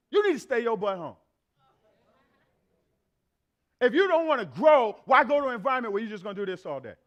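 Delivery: loud, driving sermon climax; fiery preaching. Real, not acted. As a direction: preach it hard to the pews. Preaching at full force.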